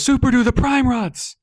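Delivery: high urgent yell to an ally; shouted call. Acted. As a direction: call out across the battle. Andy shouts to Superdu in battle.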